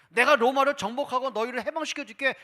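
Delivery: mock king's promise. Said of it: "왕 되겠다는 선포하세요".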